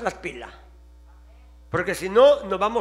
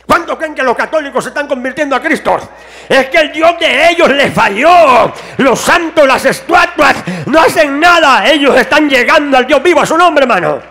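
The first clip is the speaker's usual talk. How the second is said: climbing to shouted, loud preaching; preaching at full force; 4 semitones higher, 11 dB louder than usual. Acted. Preacher rousing the congregation, shouting louder and louder. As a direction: drive it home like a fiery pastor.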